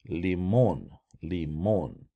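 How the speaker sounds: "limon" said lee-MON; stress on O. Shown as a demonstration. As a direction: lean on the second syllable of "limon", full oh. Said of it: "notice how the accent is on the O".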